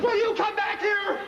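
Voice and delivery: high, drawn-out exasperated call; yelling. Acted. Husband calling wife back to him, exasperated yell. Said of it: "I don't want to yell".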